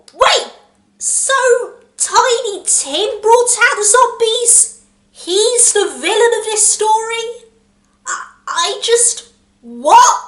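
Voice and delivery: high, slow, stunned questioning; can't believe the twist, spell it out; baffled disbelief. Real, not acted. Reviewer reacting to the twist, in stunned disbelief.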